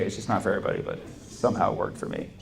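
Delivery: usual speaking voice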